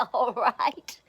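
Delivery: loud acted belly laugh into words; bursting with laughter. A narrator's reading. Gruffalo answers the boastful mouse, bursting with laughter. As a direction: laugh out loud, then speak. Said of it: "bursting with laughter".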